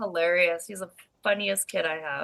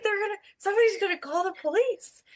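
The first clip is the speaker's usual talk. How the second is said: panicked plea; 10 semitones higher than usual. Retold or imitated. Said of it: "I'm like".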